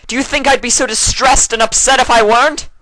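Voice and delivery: loud, high, upset outburst; distressed shouting. A narrator's reading. Luna to Celestia, still shouting high and loud.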